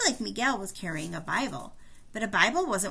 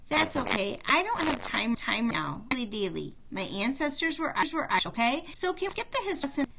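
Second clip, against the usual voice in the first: higher, brisk and impatient, hurrying at the end; impatient girl; 4 semitones higher than usual. Acted. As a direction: brush them off, she has no time. Haley impatient with mannequins.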